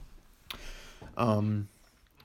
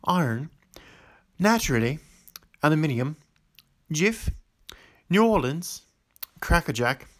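put-on amateur British accent, word by word; 4 semitones higher, 4 dB louder than usual. Vlogger retrying the challenge word list, amateur British accent. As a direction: put on British vowels for each listed word. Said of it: "Let's just do British then".

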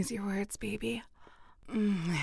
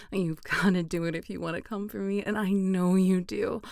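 normal talking voice

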